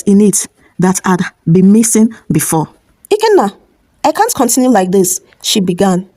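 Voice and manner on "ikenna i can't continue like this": calm, firm plea with a higher start; calm but firm. A narrator's reading. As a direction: speak up calmly but firmly. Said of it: "Her voice was calm, but there was this firmness".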